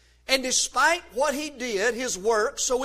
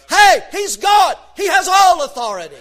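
preaching shout; 6 semitones higher, 11 dB louder than usual. Real, not acted. Preacher to his congregation about Jesus, shouting. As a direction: belt it out to the back pew.

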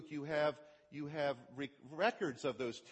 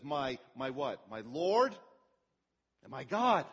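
a touch of awed cry, louder at the end; Thomas melting down; 5 dB louder, slower than usual. Retold or imitated. Thomas seeing risen Jesus, mildly overwhelmed and louder. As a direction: let the awe swell up on my God. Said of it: "Thomas just melts down".

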